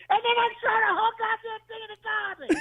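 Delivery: put-on high-pitched old aunt wail. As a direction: whine it like a fussy old aunt. [name]'s punchline in aunt voice.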